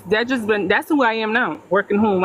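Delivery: ordinary talk, nothing marked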